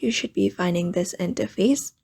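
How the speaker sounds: ordinary talk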